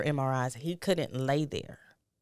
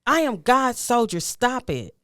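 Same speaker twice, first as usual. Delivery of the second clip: loud, high exasperated telling-off; raised voice, forceful reenacted reprimand; retold scolding; 10 semitones higher, 8 dB louder than usual. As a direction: act out a son sternly scolding his mother. Son scolds his crying mother.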